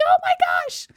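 squeaky retold gasp of words; very high, overwhelmed little squeal; high-pitched exclamation. Mom getting her lost toddler back, high-pitched exclamation.

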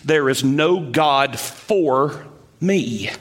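There is no God for me, slow with weight on me; stress on ME. Shown as a demonstration. To the congregation, slow emphatic meaning of the verse. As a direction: drag it out and land hard on me. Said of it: "it literally means".

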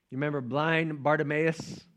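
usual speaking voice

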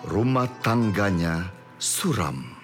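normal talking voice

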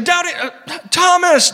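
mocking greeting. Heaven greeting, mock naming.

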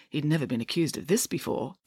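plain reading voice

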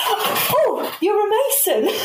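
high mock voice of an excited stranger; a high, giggly imitation of a passer-by; imitating the lady. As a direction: squeal it out like the lady, laughing. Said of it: "and then she went".

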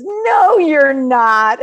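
put-on high, loud child protest; kid protesting. A first grader insisting she is not ten, high and loud. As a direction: object like an indignant kid. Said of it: "someone else says".

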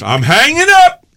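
a high, loud fed-up wife; wife hanging up. Retold or imitated. Wife Barbara ending the call, high and loud. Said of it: "Barbara goes".

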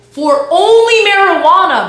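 high and loud, slowly stretched "only marijuana"; stretched-out shout of "only marijuana"; incredulous shout. Real, not acted. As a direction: belt "for only marijuana" in disbelief. Repeats the phrase, first take loud.

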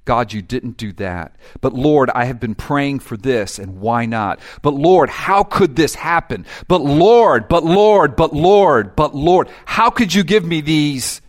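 whiny grumbler building to loud pleading; mock grumbling prayer building to loud; grumbling complaint. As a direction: complain to God, rising to loud protest. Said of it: "the one who's saying".

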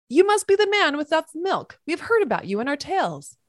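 high excited pony voice shouted up from below; shouting pony. A narrator's reading. Pony shouting up to the man with the milk, high and excited. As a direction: call up brightly as an eager pony. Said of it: "one of them shouted up at me".

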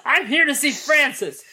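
ordinary delivery

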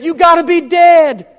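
put-on high, loud, slowed child voice, laughing through; little boy answering. Little boy answers the Sunday school teacher, high and laughing. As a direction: play the earnest little boy's punchline. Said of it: "One little boy raised his hand and said".

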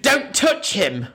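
high, drawn-out mock warning; hammed-up protective scolding, slightly amused; scolding grandma. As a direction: imitate an outraged grandma warning someone off. Imitated grandmother warning off leafleter.

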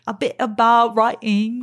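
slow, badly faked British accent. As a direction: fumble through a British accent slowly. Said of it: "That's really bad".

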